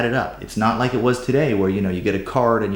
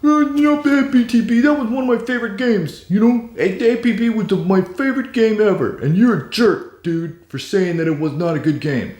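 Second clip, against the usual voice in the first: indignant fan; 11 semitones higher than usual. Retold or imitated. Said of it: "You're probably out there going".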